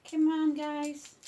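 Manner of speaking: loud, high, slow drawn-out call. Real, not acted. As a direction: stretch the call to pull them in. Calling dogs together.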